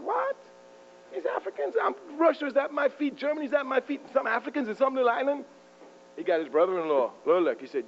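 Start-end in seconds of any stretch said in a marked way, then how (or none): 0.0-5.4 high, indignant disbelief, mocking an emperor; Napoleon scoffing. Napoleon hearing of the Haitian revolt, high scoffing disbelief. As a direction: play a haughty ruler sneering in outrage.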